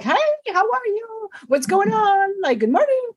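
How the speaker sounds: high, happy good-morning greeting to patients; excited greetings. Retold or imitated. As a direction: greet old friends with a lift in the voice. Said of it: "So I get excited to see them".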